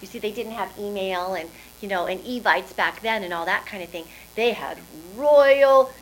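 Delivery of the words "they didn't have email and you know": ordinary delivery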